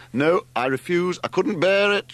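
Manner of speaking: loud, high protest; shouted. A narrator's reading. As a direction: shout him down, refusing outright. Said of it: "shouted Larry".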